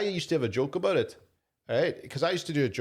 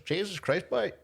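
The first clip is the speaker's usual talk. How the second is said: imitated Castlefin accent, higher start; 7 semitones higher than usual. Comic imitating an old Castlefin man he met, in accent. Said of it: "he had that accent".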